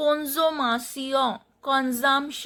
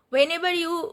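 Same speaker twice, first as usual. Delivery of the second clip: ordinary delivery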